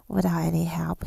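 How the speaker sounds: usual speaking voice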